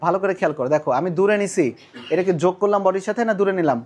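ordinary talk, nothing marked

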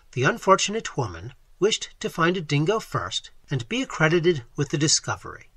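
ordinary delivery, nothing marked

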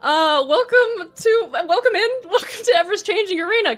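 nervous, shaky voice; shaking voice. Real, not acted. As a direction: voice trembling with nerves. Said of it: "Can you hear my voice shaking?".

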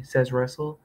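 ordinary delivery, nothing marked

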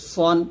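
সন with a thick s and rounded o; heavy ṣād. Shown as a demonstration. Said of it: "তবে এখানে সদ মোটা করতে হবে". (সন মোটা করে)

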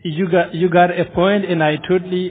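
normal talking voice

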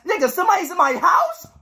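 startled by footsteps; nervous disbelief; alarmed. Real, not acted. Hears footsteps in game.

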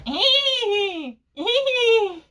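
high, laughing whinny; horse whinny attempt. Shown as a demonstration. Trying out the German horse noise, high whinny. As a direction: neigh high through "hee hee". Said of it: "Oh, maybe like this".

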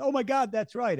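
amazed. Retold or imitated. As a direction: voice jumps high in delighted surprise. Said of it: "And they'll say".

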